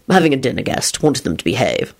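low, curt dinner host; curt reply. A narrator's reading. Lupin explains his dinner guest to Rosie, curtly. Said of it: "Lupin replied shortly".

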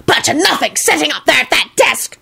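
put-on high, scornful old witch; screeching old witch. A narrator's reading. Old witch heckling the warlocks, screeched. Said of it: "screeched an old witch".

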